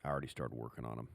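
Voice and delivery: lightly voiced coach, low, soft and fast; quick, quiet, understated quote of a coach; imitated coach. Former player retelling his coach's words, low-key. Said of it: "He goes".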